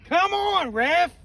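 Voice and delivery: loud, high "come on ref"; outraged shout. Real, not acted. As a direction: yell at the ref in protest. Blaming the ref after a miss.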